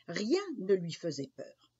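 plain reading voice

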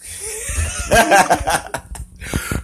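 high burst of laughter; high-pitched cackle after the punchline; laughing. Real, not acted. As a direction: laugh at your own joke. Laughing at own joke.

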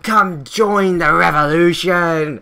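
loud, high rallying cry; hammed-up communist hailing a newcomer; revolutionary comrade. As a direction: shout a drawn-out recruiting call. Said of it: "one man is just like".

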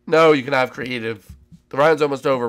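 ordinary delivery

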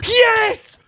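high, loud "yes"; triumphant shout. Real, not acted. Gamer whose risky test worked, triumphant shout. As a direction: burst out in delighted victory.

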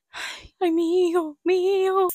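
alto y asustado, casi llorando; miedo antes de operarse. Retold or imitated. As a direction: lamento de miedo, muy agudo. (lamento asustado antes de la cirugía)

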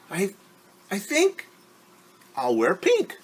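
put-on a vain girl who can't pick; Ari dithering. Ari dithering over colors.

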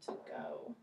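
soft, unvoiced "To go"; quiet whispered "To go"; whispered phrase. Shown as a demonstration. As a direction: breathe it as a hint. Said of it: "teacher whispers target phrase".

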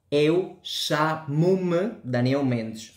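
"Eu chamo-me Daniel Mendes" very slow, European Portuguese accent. Shown as a demonstration. Teacher introducing himself as an example, slow European Portuguese accent. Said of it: "portugués de Portugal".

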